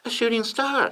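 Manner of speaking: high falsetto wife voice; Lady Bird. A narrator's reading. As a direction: answer your husband in a light falsetto. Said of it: "she said".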